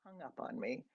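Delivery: very soft, low murmur; deflated aside. A narrator's reading. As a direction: drop to a low, hushed voice. Deflated aside after mother hangs up.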